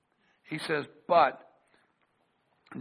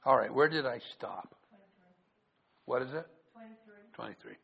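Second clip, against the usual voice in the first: usual speaking voice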